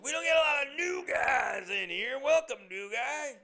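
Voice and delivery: high, loud, slow mock yell at the new guy; loud drunk regular. Dopey drunk barfly hollering at newcomer, high loud mock yell. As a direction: ham up a dopey barfly hollering across the bar.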